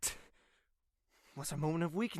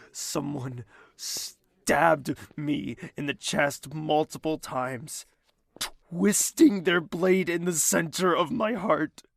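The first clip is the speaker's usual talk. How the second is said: anguished; 9 dB louder, slower than usual. Acted. Describing heartbreak pain.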